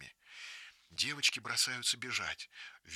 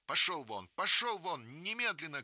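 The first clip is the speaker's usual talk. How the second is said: loud, higher shout chasing off a beast; fierce shout; 7 semitones higher, 4 dB louder than usual. Acted. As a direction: yell at the wolf to scare it. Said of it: "кричит Маруся волку".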